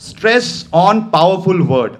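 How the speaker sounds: very slow, word by word; slow announcement. Shown as a demonstration. Trainer announcing the next point to students, very slowly. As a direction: spell out the heading for the class. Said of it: "repeating heading slowly".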